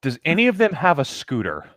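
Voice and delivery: ordinary talk, nothing marked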